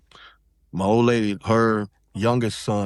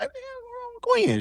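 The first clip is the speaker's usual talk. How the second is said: much higher, baffled squeal of disbelief; confused outburst; 3 dB quieter than usual. Retold or imitated. Retelling his own shock to the host, squeaking in disbelief. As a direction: jump way up in pitch, thrown by the text. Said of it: "I'm like".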